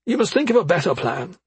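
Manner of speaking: a hint of an anxious mother; mother's voice. A narrator's reading. Effie warns.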